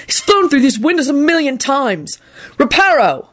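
high, exasperated rant; heated complaint, pitch up; hot yell. A narrator's reading. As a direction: rant hotly about the culprit. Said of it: "Neville yelled hotly".

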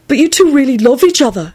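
high, earnest outburst; blurted. A narrator's reading. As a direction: blurt it out to console her. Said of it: "he blurted out".

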